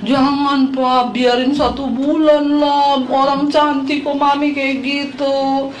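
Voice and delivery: put-on pleading little child. Youngest child begs dad to spare mum's hair, drawn-out pleading. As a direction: drag out the words like a begging child. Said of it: "Anak saya yang kecil ngomong".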